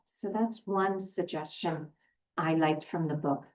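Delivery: normal talking voice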